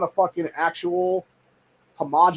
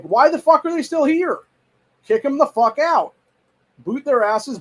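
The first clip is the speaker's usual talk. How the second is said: raised, heated rant; angry outburst; 7 semitones higher, 3 dB louder, slower than usual. Real, not acted. Ranting to viewers about deportation, angry and raised. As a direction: let the anger spill out higher.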